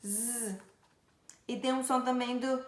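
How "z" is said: "Z" as soft sustained buzz; demonstrated Z sound. Shown as a demonstration. Teacher contrasting sounds of S, soft buzzed "Z". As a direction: buzz it softly without a vowel. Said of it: "o S tem som de".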